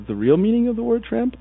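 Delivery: normal talking voice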